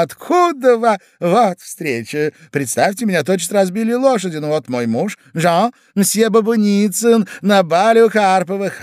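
high, singsong society chatter; chirping lady. A narrator's reading. Lady introduces husband to another gentleman, chirping. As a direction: twitter on gaily through the introductions. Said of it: "защебетала дама".

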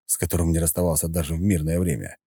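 usual speaking voice

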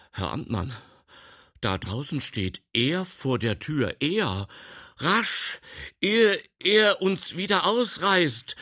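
urgent alarm, climbing to high and loud; hasty call. A narrator's reading. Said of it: "rief er hastig". (hastig und aufgeregt gerufen)

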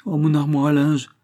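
dismayed cry. A narrator's reading. Farm wife seeing her linen cupboard ransacked, dismayed cry. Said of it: "s'écria madame Billaud".

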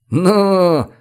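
high glad exclamation; delighted. A narrator's reading. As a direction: burst out pleased. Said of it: "обрадовался Родионов".

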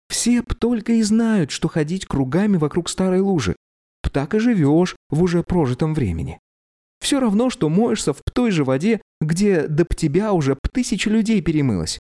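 put-on high, eager girl's voice; young woman voice. A narrator's reading. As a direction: lift it into a dreamy girl. Young girl voiced by narrator.

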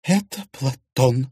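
slow, sure declaration; wife naming the suspect with confidence; confident. Acted. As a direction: state the name with certainty. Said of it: "с уверенностью заявила Виктория".

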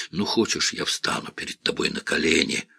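put-on drunken tearful plea, half-whispered; drunk crying. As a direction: sob it out, drunk and begging. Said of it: "Он даже пьяно всхлипнул".

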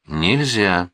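ordinary delivery

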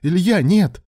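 high, loud cry of alarm; horrified protest. A narrator's reading. Alan begging Ilya not to operate, horrified protest. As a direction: cry out to stop him. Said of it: "ужаснулся Алан".

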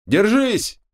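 high urgent shout; shouted warning. Acted. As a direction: yell it out fast. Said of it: "успел выкрикнуть".

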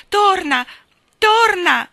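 high, very loud call to come back; piercing, urgent child's cry; pleading shout. A narrator's reading. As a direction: shout after someone walking away.

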